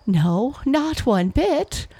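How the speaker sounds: high, drawn-out gleeful denial; mock him with rising, amused pitch; laughing scorn. A narrator's reading. Fox to trapped wolf, amused and scornful. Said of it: "laughed the fox".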